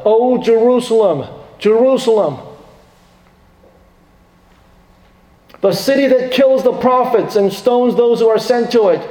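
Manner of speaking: high, grieving cry of Jerusalem, Jerusalem; anguished outcry. Acted. Jesus lamenting over Jerusalem, high anguished cry. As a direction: cry out over the lost city. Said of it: "Jesus cries out".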